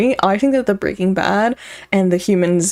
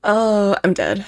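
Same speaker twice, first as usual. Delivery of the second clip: usual speaking voice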